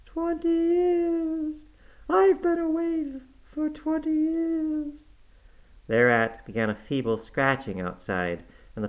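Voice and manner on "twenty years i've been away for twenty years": very high, slow mournful ghost voice; moaning ghost. Acted. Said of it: "moaned the voice".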